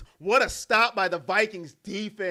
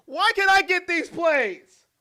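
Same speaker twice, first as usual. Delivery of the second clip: higher, louder complaint; mock outraged whine; 8 semitones higher, 8 dB louder than usual. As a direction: demand the ball like a jilted QB. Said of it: "If I'm Joe Flacco".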